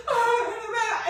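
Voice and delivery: high, loud sobbing cry; crying wail. Retold or imitated. Calling her friend Betsy, loud sobbing cry. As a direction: sob into the phone.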